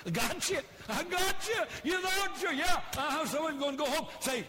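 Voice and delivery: high, loud teasing; loud laughing tease at the congregation; playful gotcha. Real, not acted. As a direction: needle the crowd gleefully. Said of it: "teasing crowd after joke".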